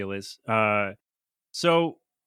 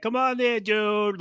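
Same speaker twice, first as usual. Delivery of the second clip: mock South Boston accent, pitched high; a hammy South Boston "dude"; bad South Boston accent; 7 semitones higher, slower than usual. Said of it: "the worst South Boston accent you've ever heard".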